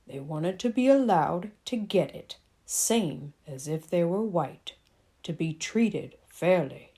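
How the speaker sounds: lightly voiced father explaining fairness. A narrator's reading. Daddy voiced in story.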